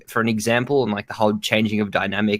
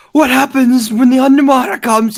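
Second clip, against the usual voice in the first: high, slow, hyped fan; excited fan voice; 13 semitones higher, 8 dB louder than usual. Retold or imitated. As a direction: squeal it like an eager fan.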